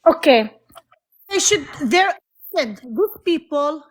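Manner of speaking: sudden loud burst, voice dropping low; loud outburst. Real, not acted. Caller cuts in with a loud, heated burst. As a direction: blurt it out hard and loud.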